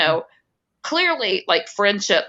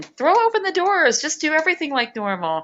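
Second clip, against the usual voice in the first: mocking politician; 10 semitones higher than usual.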